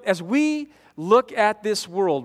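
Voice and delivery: ordinary delivery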